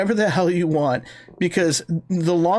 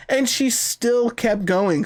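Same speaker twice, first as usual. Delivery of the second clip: slow emphasis; 4 semitones higher, much slower than usual. Real, not acted. Amazed, drawn-out emphasis.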